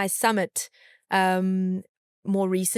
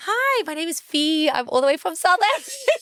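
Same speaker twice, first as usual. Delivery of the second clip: 1 eager intro; 8 semitones higher, 4 dB louder than usual. Retold or imitated. Pitching herself to Google man.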